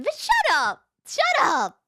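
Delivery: put-on sulky child telling everyone off; petulant shut up. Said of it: "she replied with".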